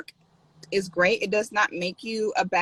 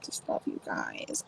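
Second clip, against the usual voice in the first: soft unvoiced whisper; whispered affection; 13 dB quieter than usual. Real, not acted. Streamer whispering affection to viewers. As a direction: whisper your love to them.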